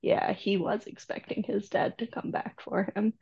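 soft, unvoiced dawning sadness; hushed realization. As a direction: whisper it as it sinks in. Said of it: "you're like".

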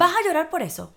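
incredulous acted telling-off; mock scolding. Acting adults scolding a crying child.

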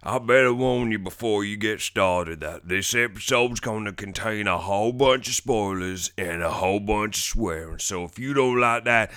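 drawling cowboy saloon welcome; cowboy drawl, warning then welcoming; cowboy host. Acted. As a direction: greet them like an old western cowboy.

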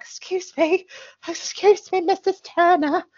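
a bag of nerves asking timidly; frightened voice. Retold or imitated. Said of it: "she's really frightened".

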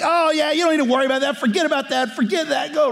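high, quick, dismissive friend voice; mock careless friend. Preacher imitates friends waving off God's word, high and flippant. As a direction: brush it off like a flippant pal.

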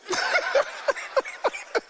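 high, long, delighted laugh; high burst of laughter. Real, not acted. Pastor laughing at his own joke, high delighted laugh.

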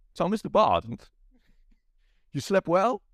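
smug villain tease; hammed-up Walken villain; Walken final take. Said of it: "one more go".

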